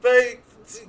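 high, laughing put-on voice; high character voice. Hatchet Jones presses the doctor, high and laughing. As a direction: play her high and urgent.

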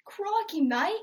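playful Aussie accent; Australian accent. Shown as a demonstration. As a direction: show off your Australian accent. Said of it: "I do a good Australian accent".

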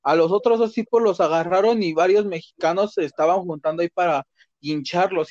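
ordinary talk, nothing marked

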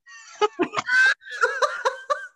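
a high, bright burst of laughter. Real, not acted. Laughing at herself for nearly losing it.

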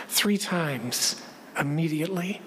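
ordinary talk, nothing marked

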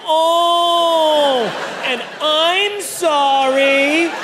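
high drawn-out "Oh" then a slow "I'm sorry"; high moan then apology. Retold or imitated. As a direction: replay the embarrassing noises, high and stretched. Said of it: "he's had to deal with".

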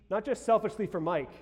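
ordinary talk

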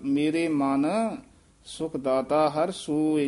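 ਮਨ said lightly, without the nasal stress; slow and careful, ਮਨ kept soft. Shown as a demonstration. Teacher shows the student the soft ਮਨ. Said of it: "ਇੱਥੇ ਪੋਲਾ ਬੋਲਣਾ ਹੈ ਧਿਆਨ ਨਾਲ".

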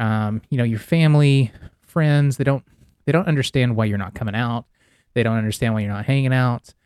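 ordinary talk, nothing marked

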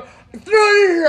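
very high, loud holler; retold shout. Runner and girlfriend calling across beach, shouting. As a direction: shout it over a long distance.

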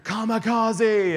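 loud, high, drawn-out yell; battle-cry yell. Retold or imitated. Kid launching himself at someone in the pool, battle-cry yell. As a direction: imitate a kid's attack cry, long and high. Kid yelling as he leaps at him.

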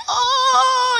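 loud high drawn-out "ohhh"; jeering "ohhh". Retold or imitated. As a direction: imitate a group of friends hooting. Friends react to the slap.

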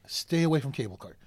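ordinary delivery, nothing marked

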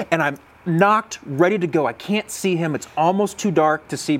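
ordinary talk, nothing marked

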